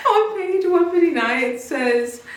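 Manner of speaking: can't keep a straight face; embarrassed giggle before reading aloud; giggling. Real, not acted. Laughing before reading spicy quote.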